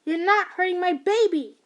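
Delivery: loud, high protective yell; protective shout. Acted. Mother shouting while tackling her son's friend. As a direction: shout her down to guard your child.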